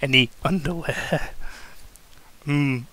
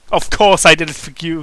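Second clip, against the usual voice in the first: plain reading voice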